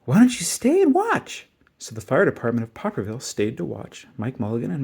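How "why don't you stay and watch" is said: put-on high, slow child's voice; a little boy inviting the firemen, high and slow. A narrator's reading. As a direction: ask sweetly like a small boy. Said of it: "the little boy said".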